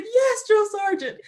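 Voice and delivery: very high, slow, drawn-out cry; hammed-up bawling reply to a superior; imitated tearful wail. Crying recruit answering drill sergeant, imitated bawling. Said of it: "I just broke down and cried".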